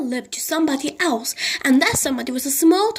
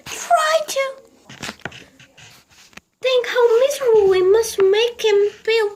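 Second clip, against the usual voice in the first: high, loud, quick plea; pleading old lady; 9 semitones higher, 3 dB louder than usual. A narrator's reading. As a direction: beg him to understand. Mrs Silver pleads.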